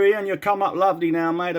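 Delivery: normal talking voice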